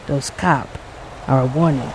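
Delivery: low, ominous warning voice; dark and low. Acted. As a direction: say it low like an omen. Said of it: "Undo said darkly".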